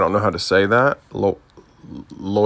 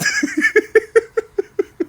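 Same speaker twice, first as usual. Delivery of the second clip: bright burst of high laughter; a high real laugh at the punchline; 4 dB louder than usual. Real, not acted. Laughing at own story.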